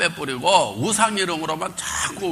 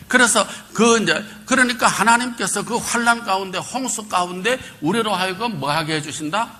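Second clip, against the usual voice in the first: preaching; 8 semitones higher than usual. Acted. Pastor building toward the point, high and ringing. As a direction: lift the flock with your voice.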